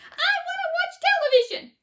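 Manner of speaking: very high, loud squeaky demand; tiny boy squealing in a tantrum. A narrator's reading. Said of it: "he squeaked".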